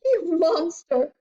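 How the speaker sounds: high, outraged accusation; indignant high-pitched tirade; shrieked. A narrator's reading. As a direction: shriek your fury at him. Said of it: "she shrieked".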